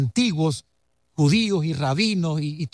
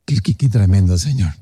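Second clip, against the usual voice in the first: lower, louder, overwhelmed with awe; awed; 10 semitones lower, 6 dB louder than usual. Real, not acted. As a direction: marvel at it aloud. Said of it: "awe at revelation". (asombro profundo, más grave)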